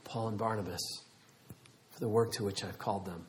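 hushed, low, intimate calling; God as tender parent. Retold or imitated. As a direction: speak very softly, like settling a crying child. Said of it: "you take their face, and you go like this".

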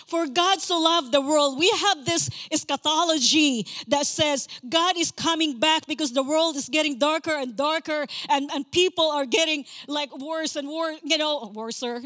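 high, loud fervent preaching; preaching at full force. Acted. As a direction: preach it out to the congregation.

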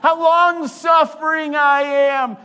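high, loud, strained show of patience; hammed-up long-suffering wail; mock long-suffering. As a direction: belt out your patience like a martyr. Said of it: "I need to be long-suffering with you".

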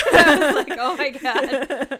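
slow laughing disbelief; laughing oh my God. Real, not acted. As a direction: drag it out through a laugh. Laughing at the spooky reveal.

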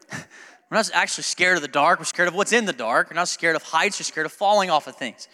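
ordinary talk, nothing marked

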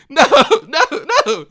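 high, loud, laughing protest; incredulous, laughing objection; emphatic refusal. Acted. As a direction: shout down a silly idea, amused. Rejecting the naive reaction.

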